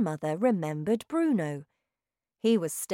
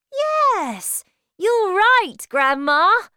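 very high, loud joyful reply; happy; 13 semitones higher, 11 dB louder, much slower than usual. A narrator's reading. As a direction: beam with delight. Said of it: "I said happily".

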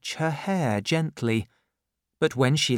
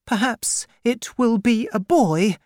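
put-on brave young widow; 10 semitones higher, 4 dB louder than usual. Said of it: "quite bravely".

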